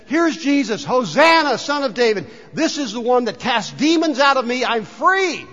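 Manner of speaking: high, loud, joyful proclaiming; ecstatic with joy. Acted. Preacher voicing a freed woman hailing Jesus, ecstatic. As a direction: cry it out like someone overjoyed to be freed. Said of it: "ecstatic with joy because she says".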